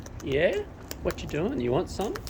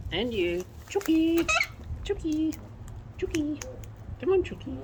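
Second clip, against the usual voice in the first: high falsetto pet call; high, repeated coaxing call; calling a bird; 12 semitones higher, 3 dB quieter than usual. Real, not acted.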